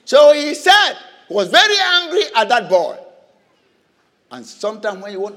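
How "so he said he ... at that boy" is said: preaching at full force. Real, not acted. Preacher urges the church to turn anger on the enemy, forceful. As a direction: drive it home from the pulpit.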